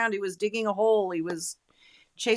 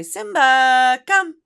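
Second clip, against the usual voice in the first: high, loud sing-song call of the dog's name; pleading call; 7 semitones higher, 13 dB louder, slower than usual. Shown as a demonstration. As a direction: coax the dog with a high voice. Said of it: "is not the same as when you say".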